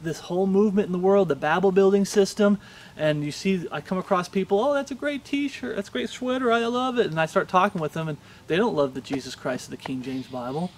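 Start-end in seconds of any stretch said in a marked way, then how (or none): 4.6-7.1 high-pitched imitation of an admirer; mock gushing. Preacher mimicking people admiring a t-shirt, mock gushing. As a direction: gush in a put-on high voice.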